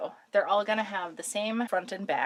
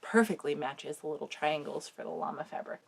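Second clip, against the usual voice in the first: ordinary talk, nothing marked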